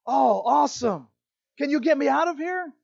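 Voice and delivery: high, loud, eager plea; hammed-up hope of rescue; relieved excitement. Jack asking God to get him out, excited.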